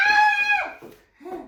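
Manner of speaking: high, loud delighted shriek; imitated toddler shriek. Imitating the kid's squeal while being chased, high and loud. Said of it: "He's like".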